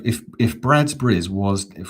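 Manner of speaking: ordinary talk, nothing marked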